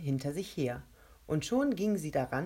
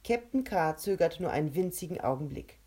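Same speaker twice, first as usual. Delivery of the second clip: ordinary delivery, nothing marked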